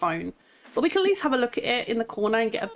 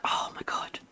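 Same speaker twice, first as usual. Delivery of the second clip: whispered oh my god; a stunned little whisper; awed whisper; 7 dB quieter than usual. Real, not acted. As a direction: breathe it out in awe. Overwhelmed by good villagers.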